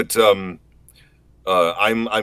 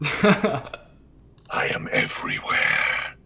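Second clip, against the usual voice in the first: very slow, drawn-out whisper; Omen voice line; 8 semitones higher, much slower than usual. Acted. Answering the streamer's joke, very slow whisper. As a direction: stretch it out from the shadows.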